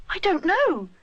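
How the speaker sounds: doubtful. A narrator's reading. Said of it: "says Rupert doubtfully".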